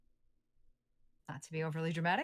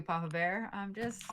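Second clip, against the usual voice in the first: normal talking voice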